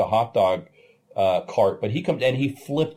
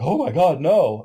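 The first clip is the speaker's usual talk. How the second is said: mock gasping crowd; 6 semitones higher, 4 dB louder than usual. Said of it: "I hear people gasping".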